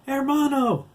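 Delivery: high, loud, long-drawn cry; horrified cry. A narrator's reading. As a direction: scream for a brother being taken. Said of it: "Max gasped".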